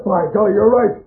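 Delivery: gleeful laughing triumph. Acted. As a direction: laugh out at the fortune. Miners find the rich vein.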